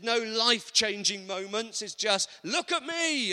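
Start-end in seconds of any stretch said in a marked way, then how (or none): 2.4-3.3 high, loud attention-seeking cry; mock show-off shout, pitched way up. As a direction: call out for everyone to notice you. Mocking show-off's prayer.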